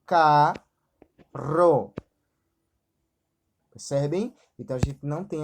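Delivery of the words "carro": "carro" split into ca and rro, loudly; syllable division. Shown as a demonstration. Teacher showing rr is not separated, "carro" said loudly as ca, rro. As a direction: say "carro" in two separate syllables, keeping the rr together. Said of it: "R não se separam".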